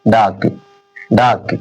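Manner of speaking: डाक said low, twice; pronunciation demo. Shown as a demonstration. Teacher models डाक for students, twice. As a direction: model डाक with a clear ए sound. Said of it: "अब इसे क्या पढ़ा जा रहा है?".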